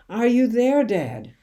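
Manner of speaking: high, loud desperate call; shouting. A narrator's reading. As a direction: shout into the dark, begging for an answer. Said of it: "I shouted".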